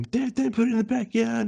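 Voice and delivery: put-on high, pleading child's voice; pleading kid. Scared boy begging dad about the spider, high pleading. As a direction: beg like a scared little boy. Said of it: "And I remember saying to him".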